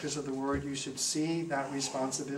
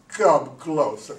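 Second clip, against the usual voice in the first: slow, lightly gruff and louder; gruff old nun; 11 dB louder, much slower than usual. Retold or imitated. Dying nun beckoning priest, slow mock gruff. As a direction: drag it out gruffly, holding back a laugh. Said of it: "but with a real gruff tone".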